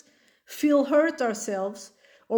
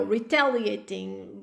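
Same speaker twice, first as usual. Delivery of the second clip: ordinary delivery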